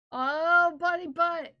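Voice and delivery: loud, high excited squeal. Real, not acted. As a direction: gush over it loudly. Gushing over bunny photo.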